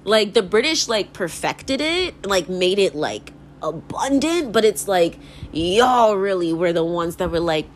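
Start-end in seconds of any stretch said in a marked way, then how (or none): none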